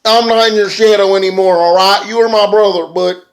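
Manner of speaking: hammed-up champion gloating at his brother; imitating Matt Hardy. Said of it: "Matt Hardy goes".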